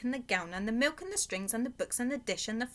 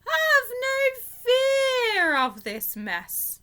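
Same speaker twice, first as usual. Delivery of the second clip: high, slow and loud showman's promise; grand reassuring cat; 12 semitones higher, 6 dB louder, much slower than usual. A narrator's reading. The Cat in the Hat calming the children, high and slow. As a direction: soothe them like a showy magician. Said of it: "said the Cat in the Hat".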